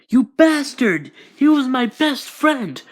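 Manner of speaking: high, loud, raging yell at the killer; furious shout. Acted. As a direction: scream it at him in fury. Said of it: "Twice is very angry".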